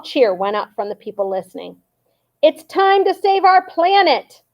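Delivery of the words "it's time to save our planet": high, slow, loud rally yell; dad rousing the shoppers; yelled rallying cry. A narrator's reading. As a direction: rouse the crowd. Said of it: "yelled George's dad".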